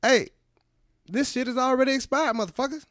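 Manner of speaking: high mock Jackson disgust; Samuel L. Jackson. Comic voicing the actor at manager special, high disgust. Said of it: "The manager special like".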